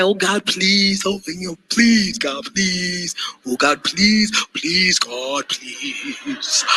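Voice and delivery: whiny pleading prayer, fading to soft; mock begging prayer. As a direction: beg God like a desperate pleader. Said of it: "instead of begging for it, pleading for it, pray".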